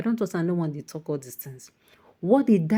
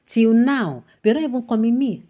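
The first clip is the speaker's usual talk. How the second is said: louder and higher; outraged; 5 semitones higher, 7 dB louder than usual. Real, not acted. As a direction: hammer the point home. Angry complaint.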